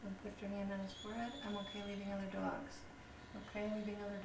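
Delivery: soft, fast aside instruction; quick quiet aside. Real, not acted. Healer quietly correcting the owner mid-session, soft and fast. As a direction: slip in a hushed correction.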